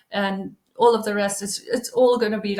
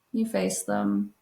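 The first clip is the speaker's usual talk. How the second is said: low quiet echo; 12 semitones lower, 4 dB quieter, slower than usual. Shown as a demonstration. Coach echoing her advice to viewers, low and soft. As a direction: repeat it much lower and softer, slowly.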